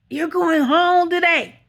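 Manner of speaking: loud, high, drawn-out call; acted-out announcement. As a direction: sing it out loudly like big news. Acts out staff announcing discharge.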